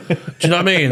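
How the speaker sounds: high, fast words through a laugh; giggling at the silly plan. Real, not acted. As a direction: laugh it out quickly. Laughing at his own joke.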